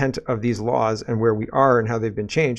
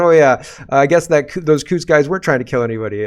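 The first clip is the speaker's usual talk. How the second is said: loud, raised mocking quote; sarcastic mock admission; 3 semitones higher, 6 dB louder, faster than usual. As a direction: imitate a grudging admission with loud scorn. Sarcastically voicing the officials' admission.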